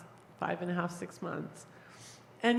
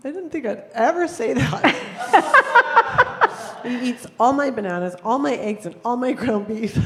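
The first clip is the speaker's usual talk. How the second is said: laughing while listing the food he eats, louder and higher; laughing through words; 4 semitones higher, 9 dB louder than usual. Real, not acted. Joking to the circle about her student eating everything, laughing. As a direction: giggle through the list.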